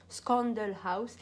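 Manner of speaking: Scandal House said slowly in careful English; English brand name. Shown as a demonstration. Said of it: "Excusez mon anglais". (Scandal House prononcé lentement à l'anglaise)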